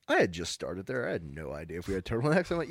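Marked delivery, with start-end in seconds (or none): none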